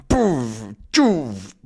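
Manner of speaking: plain reading voice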